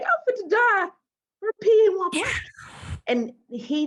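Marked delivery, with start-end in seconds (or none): 0.0-2.4 freaked out. Retold or imitated.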